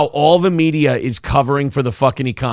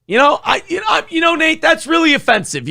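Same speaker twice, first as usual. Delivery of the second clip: high, shouted fake offense; mock outrage; 12 semitones higher than usual. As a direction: squeal in hammed-up outrage. Mock outrage at listener comment.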